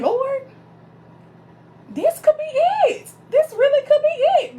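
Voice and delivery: high, loud breathless hope to the Lord; thrilled high plea to God; hopeful prayer. Retold or imitated. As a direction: gasp it out like a prayer being answered. Praying for the right man.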